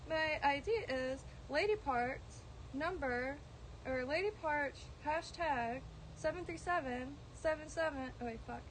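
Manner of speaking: normal talking voice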